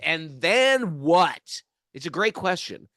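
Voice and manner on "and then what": loud, high, drawn-out challenge; hammed-up booming demand; insistent questioning. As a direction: press the question hard, stretching THEN and WHAT. Retold sage's question, built to climax.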